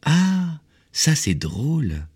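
put-on high, drawn-out amused child; high, slow, tickled little voice; little prince. A narrator's reading. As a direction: stretch it out in a small boy's delight.

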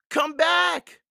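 very high, loud shout. A narrator's reading. Annie shouts after Jack near the water, alarmed. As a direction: shout after someone running into danger. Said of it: "Annie shouted".

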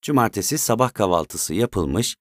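ordinary delivery

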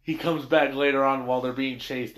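usual speaking voice

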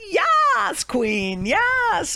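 very high, drawn-out squeal of approval; mock gushing cheer. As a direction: cheer a friend on in hammed-up falsetto. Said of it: "he'd be like".

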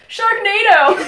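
very loud, high battle cry; imitated gleeful yell. Girl on the team calling a joke play, yelling. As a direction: holler it, breaking into laughter. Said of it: "this one girl on my team yelled".

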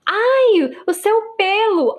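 high, whining complaint in his voice; whiny boyfriend. Retold or imitated. Big rugby boyfriend mocked, complaining that her leg hair pricked him, in a high whine. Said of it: "Ele falou assim".

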